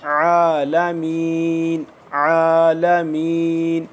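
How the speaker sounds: आलमीन very slow, ain firm from the throat; slow drawn-out recitation. Shown as a demonstration. Teacher drills आलमीन for students, very slow. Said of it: "हलक से निकालेंगे सही करके थोड़ा सख्ती से".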